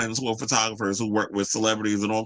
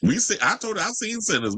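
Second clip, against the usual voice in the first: much higher, knowing jab; sassy exclamation; 9 semitones higher than usual. Real, not acted. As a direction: insist with a pointed, cheeky tone.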